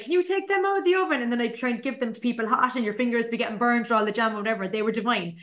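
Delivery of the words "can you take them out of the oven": high, quick, pleading call; urgent child request. Retold or imitated. Child baker begging parents to pull buns out, high and quick. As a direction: chirp it up fast like an eager kid. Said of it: "and I'd be like".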